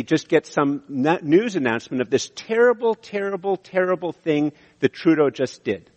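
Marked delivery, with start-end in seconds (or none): none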